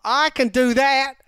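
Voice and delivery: put-on high, drawn-out Boston accent; game stab at Boston. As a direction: try on a loud Boston accent. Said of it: "try to do a Boston accent".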